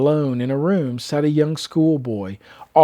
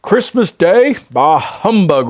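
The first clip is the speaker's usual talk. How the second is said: grumpy Scrooge; 3 semitones higher, 5 dB louder, slower than usual. Acted. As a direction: spit out the humbug in contempt. Scrooge voiced in story.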